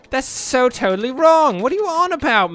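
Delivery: high, loud mock outrage; retold yell. Ranting at someone who built a bad intersection, mock yelling. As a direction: yell it at them in disbelief. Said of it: "I didn't yell that much".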